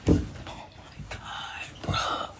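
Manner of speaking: barely audible whisper; exasperated muttering. Retold or imitated. Imitating housemate on the couch, exasperated whisper. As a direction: imitate a silent, fed-up onlooker under the breath. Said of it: "Jared like this".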